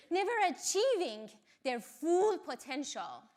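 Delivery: usual speaking voice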